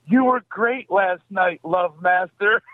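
high, drawn-out calling out; retold yell. Girl calls to comedian across the street, imitated yell. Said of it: "yells out".

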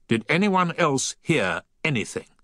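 narrated shout, pitched well up; shouted question. A narrator's reading. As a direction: call out the question to everyone within earshot. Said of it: "I shouted".

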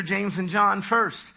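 ordinary talk

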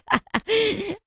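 big laugh. Real, not acted. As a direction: burst of high laughter. Laughs at listener's comeback.

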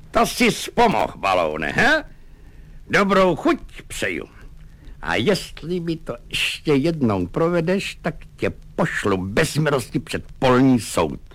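high, gloating officer's call; triumphant exclamation. Acted. Lieutenant calls out triumphantly to Baloun at the door. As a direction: crow over the tied-up orderly. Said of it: "zvolal vítězoslavně".

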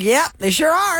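high, emphatic quoted comeback; unabashed retold reply. Host retelling McConaughey's answer about man parts, unabashed and high. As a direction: play the shameless answer up high.

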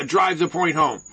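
normal talking voice

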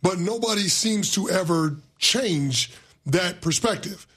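normal talking voice

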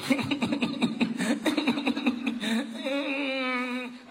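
high burst of real laughter. Real, not acted. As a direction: laugh out loud at the idea. Laughing at depressed person.

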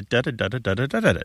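quick, even nonsense syllables tapping out a beat; rhythmic patter. Shown as a demonstration. Radio host beating out a tongue twister's rhythm, quick patter. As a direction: rattle off the rhythm of the phrase. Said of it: "it's got a rhythm".

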